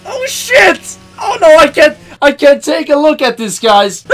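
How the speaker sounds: high, loud frightened shouts; genuinely spooked, yelling at the screen; scared screaming to viewers; real scared yelling. Real, not acted. Streamer scared by game scene.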